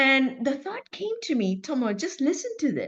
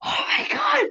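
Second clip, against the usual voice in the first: high, loud, crying out in horror; shocked shout; 11 semitones higher, 6 dB louder than usual. Retold or imitated. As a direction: scream it like you're frightened in the dark. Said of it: "I remember shouting in the dark".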